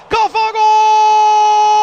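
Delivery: huge, slow drawn-out goal roar; screaming football commentator. Real, not acted. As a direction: bellow the goal call. Goal call in match commentary.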